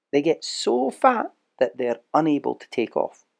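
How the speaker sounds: plain reading voice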